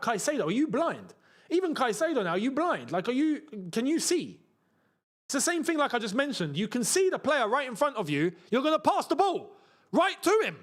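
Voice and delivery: high-pitched incredulous ranting; real exasperation, rising to a high peak; exasperated rant. Real, not acted. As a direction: rant in disbelief, pitch jumping up. Exasperated at players' passing mistakes.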